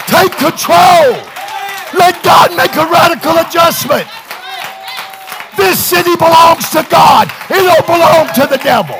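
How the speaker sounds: high, very loud revival shout; full-force preaching. Real, not acted. Preacher rallies church to take control, full-force preaching. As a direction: build to a roaring pulpit cry.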